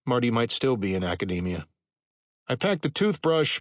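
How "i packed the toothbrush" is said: raised voice over jet noise; shouting over engines. A narrator's reading. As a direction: call out over roaring engines. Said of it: "Marty yelled over the growling of jet engines".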